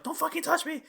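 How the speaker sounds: high, sharp mock shout as Ellie; Ellie snapping. Ellie pushing Joel away, imitated in a high pitched voice. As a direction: shove him off in a high voice. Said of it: "she's all like".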